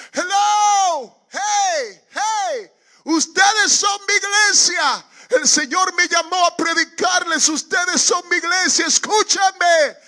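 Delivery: very high, stretched-out full-force yell; shouting out. Retold or imitated. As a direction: scream to be heard by people far away. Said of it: "empecé a gritarles".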